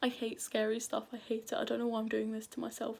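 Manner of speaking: usual speaking voice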